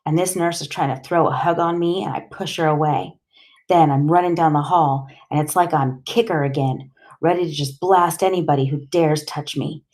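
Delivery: usual speaking voice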